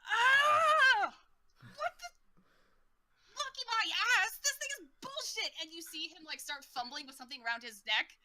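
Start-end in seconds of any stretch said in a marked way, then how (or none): 0.0-1.1 long, high, loud scream; agonized scream of a skewered fighter; pained yell. Acted. Fighter shish kebabbed in the shoulder, pained yell. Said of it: "He's like". 1.6-5.5 high-pitched, outraged ranting; enraged outburst. Acted. Impaled fighter cursing the thing round his neck, enraged outburst. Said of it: "he looks at this".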